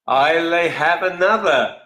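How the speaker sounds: slow mock Scottish "I'll have another"; a playful Scottish toast, drawn out; Scottish accent. Host reading a viewer's drink name, in a light Scottish accent. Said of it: "play on the whole sort of Scottish accent".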